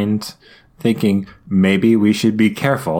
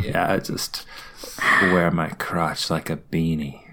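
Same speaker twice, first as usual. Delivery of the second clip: low, breathy vocal fry; 4 semitones lower, 6 dB quieter than usual. Shown as a demonstration. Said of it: "Get some vocal fry on that".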